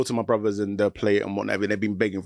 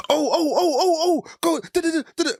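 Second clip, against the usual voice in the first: put-on high squealing kid voice, much higher; excited kids. Kids playing Minecraft interrupting dad, high squeals. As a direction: play an overexcited child squealing.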